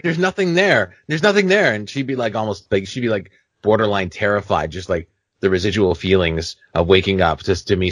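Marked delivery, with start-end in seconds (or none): 0.0-1.7 high-pitched imitation of an insistent wife. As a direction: insist it in a raised mock-wife voice. Wife insisting to panicked husband.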